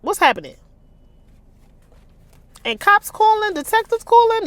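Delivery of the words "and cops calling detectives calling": high, loud surprised squeal; can't believe what she's reading; shocked disbelief. Real, not acted. Reader reacts to plot twist.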